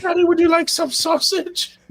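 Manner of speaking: high-pitched mock kid asking daddy; imitating Freddy Got Fingered. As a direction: squeal it like a goofy man-child. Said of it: "and he's like".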